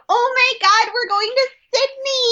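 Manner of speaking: high-pitched mock squeal of excitement; excited squealing girls. All the girls reacting to Sydney news, mock excited squeal. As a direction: gush like a crowd of thrilled girls. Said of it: "all the girls are like".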